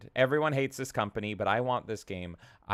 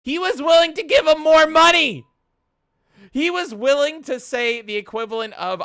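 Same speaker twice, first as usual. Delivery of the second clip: incredulous shout; 12 dB louder than usual. Real, not acted. Incredulous rant.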